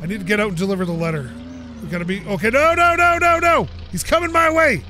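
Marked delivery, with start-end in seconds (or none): 2.5-4.8 high, loud panicked pleading; frantic shouted no no no. Real, not acted. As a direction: cry out as the car bears down. Car heading straight at him.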